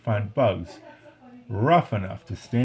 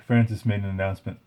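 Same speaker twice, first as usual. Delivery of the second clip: ordinary delivery, nothing marked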